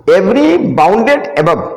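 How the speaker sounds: very slow, every word spaced out; slow reading. Shown as a demonstration. Teacher reading the theorem statement slowly to students. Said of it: "पहले statement पढ़ दें".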